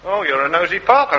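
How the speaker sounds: ordinary talk, nothing marked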